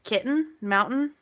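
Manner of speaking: "kitten, mountain" slowly, T's swallowed; dropped T's. Shown as a demonstration. Showing a co-host the Utah accent, T's dropped in "kitten, mountain". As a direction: say "kitten, mountain" with the middle T's left unpronounced. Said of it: "you don't pronounce your T's".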